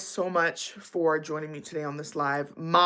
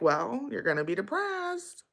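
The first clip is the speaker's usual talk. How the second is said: soft sing-song, pitched up; mock-sweet personified gloom; insistent depression voice; 4 semitones higher, 6 dB quieter than usual. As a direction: coax playfully, stretching the words upward. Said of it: "And it's like".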